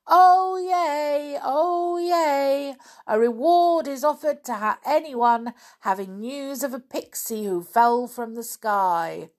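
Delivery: high, loud proclamation easing towards the end; town crier's cry. Acted. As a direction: call out the news like a town crier. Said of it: "crying loudly".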